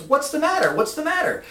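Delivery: high, anxious questioning; puzzled alarm. Retold or imitated. Lance pulled down the street by boss, high bewildered questions. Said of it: "And Lance goes".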